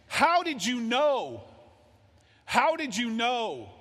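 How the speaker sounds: high, loud, slow cry of shock; retold scream. Woman on the phone screaming at the caller. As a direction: cry it out as if stunned by the call. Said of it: "just screamed".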